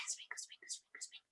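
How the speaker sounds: whispered sprinkle spell; magic whisper. Pretend. Teacher sprinkling pixie dust on the children, whispering. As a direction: breathe it like fairy magic.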